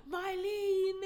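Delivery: high, pleading call of Mylene; imitating his call. Friend imitating the man calling to her, lightly voiced. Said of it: "the scene where he's like".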